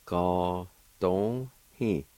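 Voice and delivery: Ga dong hi drawn out slowly, each syllable clear; slow careful syllables. Shown as a demonstration. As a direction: stretch out Ga dong hi for learners. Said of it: "Second part".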